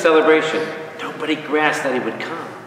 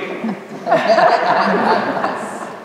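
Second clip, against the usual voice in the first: airy amused laugh; breathy laugh; 6 semitones higher than usual. Real, not acted. Preacher laughing at his own joke to the congregation, airy laugh.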